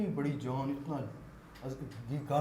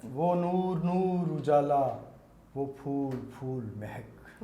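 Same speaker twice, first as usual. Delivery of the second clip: slow, measured verse; naat recitation. Acted. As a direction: recite each word with weight. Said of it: "نعت کے چند شعر پیش کرنا چاہوں گا".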